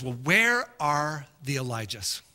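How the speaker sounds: a hint of a soft, slow divine voice; low and quiet, gently turning the question back; God answering. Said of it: "He says".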